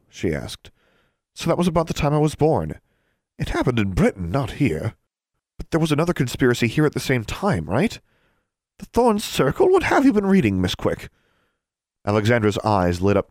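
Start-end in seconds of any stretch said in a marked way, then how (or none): 1.3-8.0 put-on higher-pitched girl pressing a question; eager young student probing for answers; inquisitive schoolgirl. Curious pupil questions her teacher. 8.8-11.1 high-pitched incredulous questioning; taken-aback teacher, voice shooting up; startled teacher. Acted. Startled teacher challenges her pupil.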